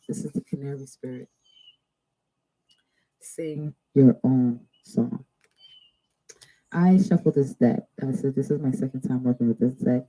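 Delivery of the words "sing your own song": slow, drawn-out card reading; gentle slow repeat of the card; intoned slowly. Acted. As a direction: savour each word of the message.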